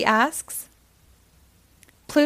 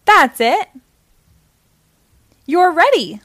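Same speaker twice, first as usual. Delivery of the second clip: higher, louder triumphant cheer; delighted; 7 semitones higher, 8 dB louder than usual. A narrator's reading. Fairy godmother pleased with the finished look, delighted. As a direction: celebrate the spell working. Spell finally works.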